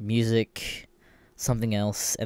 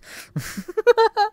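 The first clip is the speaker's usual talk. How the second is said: a very high, squealing burst of laughter; high shrieking laugh. Real, not acted. Laughing at his own complaint, high shriek.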